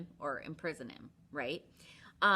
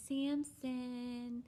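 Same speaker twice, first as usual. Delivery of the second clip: higher coaxing call of his name; sweet Delilah; 6 semitones higher than usual. Retold or imitated. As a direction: coax him sweetly. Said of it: "She's like".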